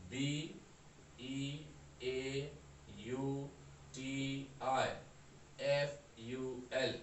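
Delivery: ordinary talk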